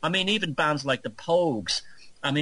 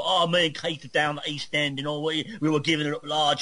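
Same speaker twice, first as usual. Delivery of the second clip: higher-pitched put-on Mockney accent; mock Cockney; 4 semitones higher than usual. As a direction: imitate rock stars faking an East End Cockney accent. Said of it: "This is, like, Mockney kind of thing".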